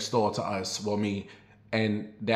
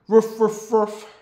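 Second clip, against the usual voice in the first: high, louder mock barking; the loose dog charging at her, barking; dog bark imitation; 10 semitones higher, 5 dB louder, slower than usual. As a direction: bark like a charging pit bull. Dog runs barking in street.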